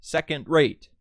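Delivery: second rate with SECOND and RATE both hit; two stresses. Shown as a demonstration. Teacher gives another example, second rate double-stressed. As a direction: slowly punch both words of second rate. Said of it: "two stresses".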